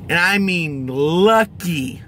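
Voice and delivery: LUCKY drawn out slowly; stress on lucky. Real, not acted. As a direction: lean hard and long on lucky. Repeats the word for emphasis.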